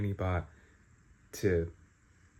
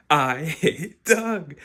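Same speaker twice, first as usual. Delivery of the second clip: loud, high, slow exclamation; exasperated outburst; 7 semitones higher, 8 dB louder, much slower than usual. Real, not acted. Reader venting at the book's villain, loud drawn-out outburst. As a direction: draw out each word in loud outrage. Outraged reaction to plot turn.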